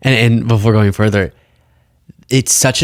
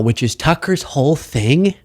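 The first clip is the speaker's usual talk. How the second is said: ordinary talk, nothing marked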